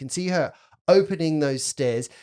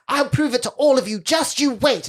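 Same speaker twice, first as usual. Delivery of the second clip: put-on higher, louder childish vow; defiant little girl; 11 semitones higher, 5 dB louder than usual. Girl storming off to prove it, defiant. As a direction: announce it in a huff. Girl vows, quoted by game master.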